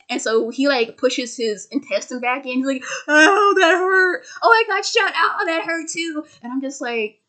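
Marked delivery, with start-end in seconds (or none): 3.1-6.2 high, whimpering mock cry of pain; hammed-up hurt whimper, higher and louder; mock whiny pain. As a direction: whine it like a wounded crybaby. Imitating hero whining in pain.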